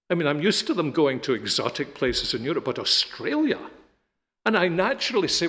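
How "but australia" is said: high rising disbelief; incredulous. Retold or imitated. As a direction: question the place as if astonished. Father repeats it in disbelief.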